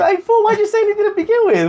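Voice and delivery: high, incredulous mock voice; mocking the homie who backed out; mock exasperation. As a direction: throw it up in disbelief.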